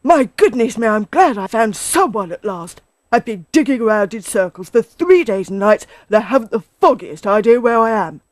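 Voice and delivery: loud, relieved storybook badger; agitated, loud animal character; flustered Badger. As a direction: pour it out to a friend.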